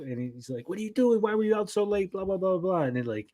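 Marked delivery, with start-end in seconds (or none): 0.7-2.8 high-pitched mock scolding; high, mild mock yell trailing into blahs; retold scolding yell. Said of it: "comes over and yells at Kotaro".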